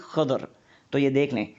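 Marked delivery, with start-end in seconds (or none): 0.0-0.4 خضر said the Arabic way, slowly, with a guttural خ and a clear ض; Arabic pronunciation. Shown as a demonstration. Teacher showing viewers the Arabic pronunciation of خضر, slow and careful. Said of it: "Arabic pronunciation کے مطابق".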